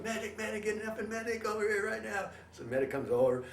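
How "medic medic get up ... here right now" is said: urgent call. Retold or imitated. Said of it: "Dave's going".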